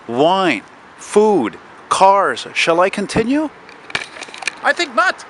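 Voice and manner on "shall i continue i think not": haughty. Acted. Haughty rhetorical aside.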